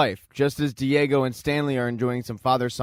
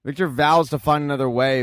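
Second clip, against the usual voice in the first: ordinary delivery, nothing marked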